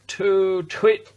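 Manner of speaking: cross hoot. A narrator's reading. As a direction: hoot it out crossly like an offended owl. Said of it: "said the owl crossly".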